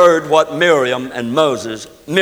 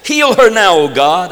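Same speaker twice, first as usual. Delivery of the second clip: crying to God; 5 dB louder than usual. Acted. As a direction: cry out to God for healing. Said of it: "Moses cried unto the Lord".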